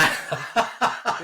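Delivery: high unvoiced laugh bursting out; burst of laughter. Real, not acted. Hearing bants, a high burst of laughter. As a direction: laugh out loud at the slang.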